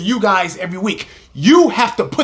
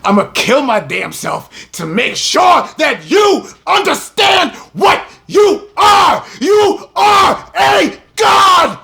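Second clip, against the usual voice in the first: impassioned shout; 7 semitones higher than usual. Real, not acted. Preacher at full force urging his people to know they are gods, straining shout. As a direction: throw everything into the last word.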